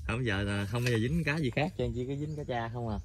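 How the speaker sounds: ordinary talk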